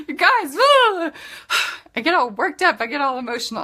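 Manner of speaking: high, loud tearful laugh; emotional laugh. Real, not acted. Preacher overcome by her own pep talk, laughing and teary. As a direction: laugh through rising tears. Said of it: "I'm about to start crying".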